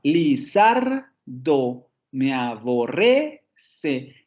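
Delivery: "Lizardo me aborrece" very slow, ZAR and RRE rising; drawn-out "li-ZAR-do me-a-bo-RRE-ce"; stress on second and sixth syllables. Shown as a demonstration. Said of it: "el primer acento cae en la segunda sílaba".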